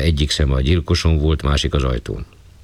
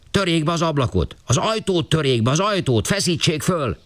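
higher, pleading and urgent; higher, anxious caretaker pushing to force the door; agitated caretaker; 13 semitones higher than usual. Acted. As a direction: insist to the gentleman, then urge them to break the door. Caretaker urging to break in.

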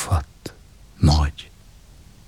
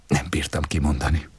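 plain reading voice

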